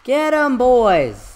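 high, loud rallying shout; drawn-out, high-pitched holler; battle cry. Real, not acted. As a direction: yell it like you're sending in the troops. Hyped battle cry in game.

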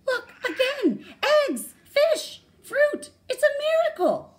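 very high, loud cries of amazement; overjoyed storybook mama, squealing; excited exclamations. A narrator's reading. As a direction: shriek each discovery in delight. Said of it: "exclaimed Mama".

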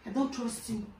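usual speaking voice

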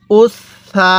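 উস সা, long held s across both syllables; doubled consonant. Shown as a demonstration. Teacher leading learners to read along, doubled s held long. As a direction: hold the s from উস into সা. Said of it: "আমি যেভাবে পড়ব আপনিও সেভাবে".

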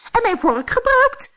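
very high and loud; high-pitched storybook outcry; indignant dwarf cry. A narrator's reading. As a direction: pipe up as a tiny affronted dwarf. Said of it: "riep de vijfde".

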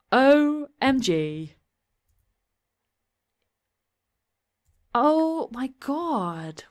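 slow, high, drawn-out disbelief; stunned. Real, not acted. Player reacting to the shock in the game, slow stunned exclamation. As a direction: stretch each word out in astonishment.

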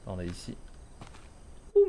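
usual speaking voice

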